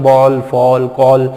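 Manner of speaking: "बॉल, फॉल, कॉल" each with rounded ऑ; resonant aw sound. Shown as a demonstration. Teacher showing the double-L vibration, rounded aw sound. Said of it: "जब ऐसा vibration जैसा होता है".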